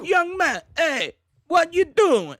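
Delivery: high, loud mock Italian yell; drawn-out Italian-sounding shout at a kid; botched Boston turned Italian; accidental Italian accent. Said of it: "Oh, that's Italian".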